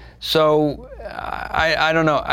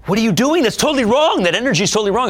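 high, loud mock scolding; mock angry director; 13 semitones higher, 6 dB louder, faster than usual. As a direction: scold him like a furious director. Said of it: "And she's like".

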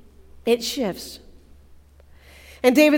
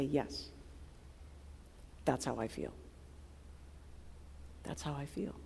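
much softer, lower confession; hushed agreement; 8 semitones lower, 20 dB quieter than usual. Retold or imitated. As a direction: murmur it as a quiet admission.

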